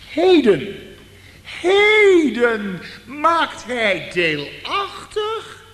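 Heden, heden drawn out high and loud; slow emphatic heden. Real, not acted. Preacher urging the congregation, slow and emphatic. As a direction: stretch out heden slowly.

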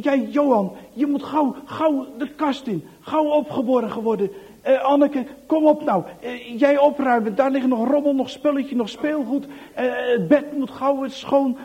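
high, hurried frightened commands; urgent orders. Acted. Anneke hurrying Johan into the cupboard, frightened urgency. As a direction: rush them to hide, scared.

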